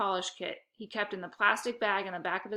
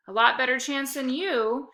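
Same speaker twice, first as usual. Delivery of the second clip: snarled back; 5 semitones higher, 5 dB louder than usual. A narrator's reading. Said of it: "she snarled back".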